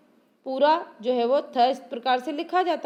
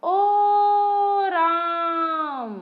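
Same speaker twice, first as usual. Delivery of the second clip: "ओ राम" called with a long held ओ, higher and louder; calling out long; 5 semitones higher, 3 dB louder, much slower than usual. Shown as a demonstration. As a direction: call across a distance, stretching the ओ. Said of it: "किसी को ऐसे बुलाते हो ना". (दूर से पुकारते हुए लंबा "ओ राम")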